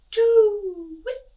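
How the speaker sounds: high, long, loud hoots; swooping drawn-out owl call; hooting owl. A narrator's reading. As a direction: hoot like an owl at night. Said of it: "went the owl".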